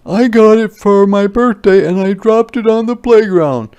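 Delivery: put-on high, loud upset boy; crying out. Martin cries out to the teacher, high and loud. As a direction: wail it to the teacher. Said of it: "cried Martin".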